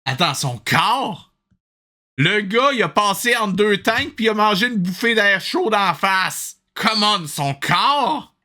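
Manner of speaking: can't believe what just happened; incredulous. Real, not acted. Streamer protesting the game's absurd death, incredulous.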